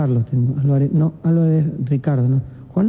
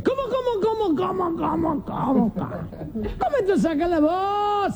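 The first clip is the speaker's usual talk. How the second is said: put-on high, wailing drunk, drawn out and softer; 11 semitones higher, 6 dB quieter, slower than usual. Drunk butting in on hesitant men, high drawn-out disbelief. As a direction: stammer in disbelief like a squealing drunk. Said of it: "Y un borracho dice".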